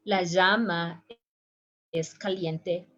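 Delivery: llama with a zh sound, "zhama"; llama voiced like the s in measure; second way. Shown as a demonstration. Teacher demos the second llama sound. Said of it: "three different sounds".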